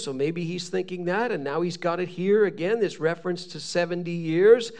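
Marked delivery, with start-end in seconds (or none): none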